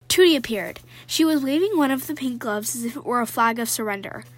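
put-on lively read-aloud of a children's novel; story reading. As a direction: read the chapter to your viewers with energy. Reads book excerpt aloud.

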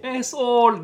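shouting out. Retold or imitated. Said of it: "qui criait".

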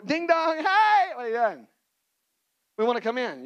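high, loud sing-song doorbell visitors; mock visitors at door. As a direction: chime it like pushy guests at the door.

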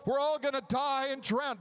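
hammed-up cry for help in a storm; panicked disciples.

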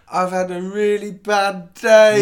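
loud, slow mock-crying; tearful wail. Customer bursting into tears at the ticket window, tearful. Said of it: "I burst into tears".

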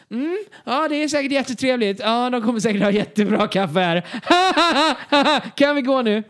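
high, strained polite chatter breaking into laughs; forced politeness. Retold or imitated. To Hanna about the café, high forced polite laughing. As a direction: fake interest while desperate. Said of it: "Och jag liksom bara".

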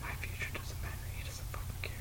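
sulky mock whisper under the breath. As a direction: whisper it as a self-pitying aside. Sulky aside after being dismissed.

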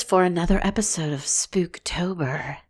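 breathy whisper, trying to sound spooky; whispery spooky voice. Acted. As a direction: whisper it like a sultry ghost story. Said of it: "I wanted to try to do a spooky voice, but all I get is, is that kinda whispery".

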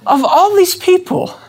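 high, bewildered you guys; pleading astonishment. Shown as a demonstration. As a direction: plead with friends in disbelief. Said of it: "listen to the question".

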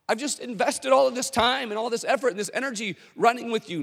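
high, exasperated complaint; frustrated follower. Retold or imitated. Crowd member complaining to Forrest, exasperated. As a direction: vent like a let-down fan. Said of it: "frustration and disappointment".

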